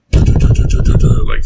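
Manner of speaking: rapid-fire loud pounding noise; imitated door banging. Drunk guy at the bedroom door, imitated loud banging. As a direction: mimic fists hammering a door, loud.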